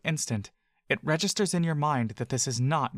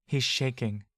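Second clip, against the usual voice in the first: ordinary delivery, nothing marked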